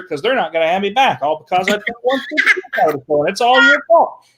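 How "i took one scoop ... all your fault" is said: higher, louder whiny accusation; mock blaming whine. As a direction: pin it all on him, mock-whining.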